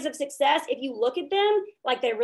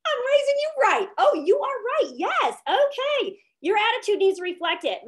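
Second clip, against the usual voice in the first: much higher, hammed-up proud mother voice; proud mom; 9 semitones higher, 3 dB louder than usual. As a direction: gush like a mom whose kid just taught her something. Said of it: "And I was like".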